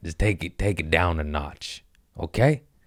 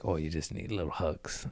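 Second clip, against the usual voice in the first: soft inner voice; 11 dB quieter than usual. Retold or imitated. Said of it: "my body's like".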